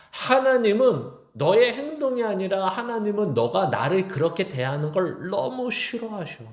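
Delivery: preacher voicing God rebuking King Saul, heated; God's rebuke. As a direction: build the scolding to a passionate peak. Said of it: "이렇게 얘기하는 겁니다".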